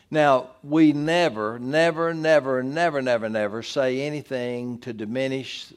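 emphatic preacher repetition; repeated never. Acted. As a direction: hammer each "never" with rising insistence. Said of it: "repeats never for emphasis".

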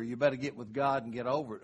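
normal talking voice

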